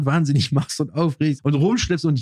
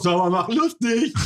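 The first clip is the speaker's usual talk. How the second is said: smug know-it-all; 8 semitones higher, slower than usual. Retold or imitated. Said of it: "dann sagt irgendein Arschloch".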